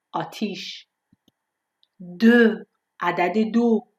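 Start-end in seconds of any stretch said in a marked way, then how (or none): 2.2-2.7 دو said as French deux, rounded vowel between o and e; emphatic French "deux" for دو; correct French eu. Shown as a demonstration. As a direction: model دو as deux, louder and held. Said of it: "یعنی دو نیست".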